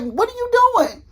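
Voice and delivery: high, loud panicked wait, what; alarmed. Retold or imitated. Told she's about to be taken in, panicked high shriek.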